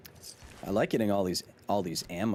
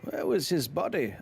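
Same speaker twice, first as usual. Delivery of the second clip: higher-pitched put-on Aussie voice; Australian accent; 6 semitones higher than usual. Streamer defying chat's complaint with the Australian accent. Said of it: "when you do the Australian accent".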